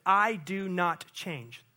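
slow, each word weighed; slow emphatic. Acted. Preacher quoting God to the church, slow and weighty. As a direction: lay it down like a vow.

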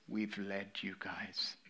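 solemn hushed whisper trailing off. Real, not acted. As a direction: let the words fall to a sober whisper. Solemn close about giving account.

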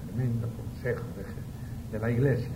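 ordinary delivery

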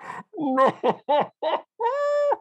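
laughing to tears; helpless high wailing laugh; high crying laugh. Real, not acted. Reacting to the prank story.

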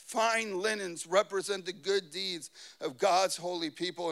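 ordinary talk

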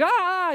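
sudden high yelp of fright; frightened scream. Retold or imitated. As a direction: shriek like you've seen a ghost. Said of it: "And they're like".

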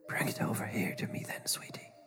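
unvoiced eerie old woman whisper; creepy whisper. A narrator's reading. Grandmother creepy summons.

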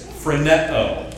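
phroneo said slowly as fro-NEH-oh; Greek word demo. Shown as a demonstration. Pastor teaching church the Greek word phroneo slowly. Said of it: "everybody say it with me".

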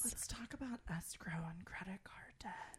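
soft seductive whisper; sexy whisper. Pretend. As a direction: make dull words sound sultry. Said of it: "in our sexy voices".

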